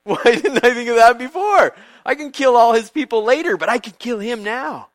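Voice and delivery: high, loud scheming delight; hammed-up wicked glee; delighted villain. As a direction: Haman thrilled by his wife's idea. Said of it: "So Haman's like".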